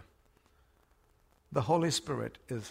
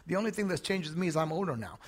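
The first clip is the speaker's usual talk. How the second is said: normal talking voice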